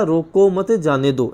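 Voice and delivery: रोको मत joined in one slow phrase; no pause after रोको. Acted. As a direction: link मत onto रोको, slowly. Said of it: "ऐसे समझ लिया आपने".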